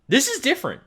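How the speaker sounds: high, emphatic realization; excited. Real, not acted. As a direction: hit it like a sudden discovery. Sudden realization about new class.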